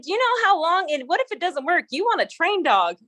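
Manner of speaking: high, insistent imitated argument; animated mock protest; retold persuading. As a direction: talk someone out of it, pitched well up. Kobe talking the boy out of it.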